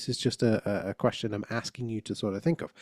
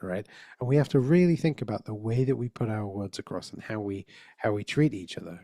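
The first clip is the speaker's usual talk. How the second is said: ordinary talk, nothing marked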